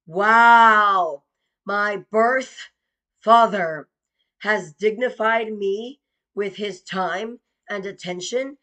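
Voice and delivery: loud mock amazement, each word hammered; sarcastic loud wow. Daughter mocking her absent father, loud sarcastic wow. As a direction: scoff loudly at the idea of a birth father.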